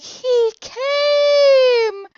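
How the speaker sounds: put-on high, loud, drawn-out child's cry; imitating a little kid screaming with joy; excited little kid. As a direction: shout it as a thrilled child on Christmas. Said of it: "my little voice".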